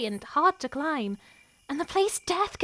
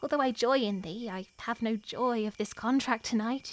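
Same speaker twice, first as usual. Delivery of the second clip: ordinary talk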